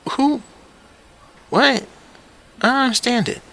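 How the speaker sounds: high, loud bewildered questions; baffled. Real, not acted. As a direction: sound totally baffled. Baffled outburst.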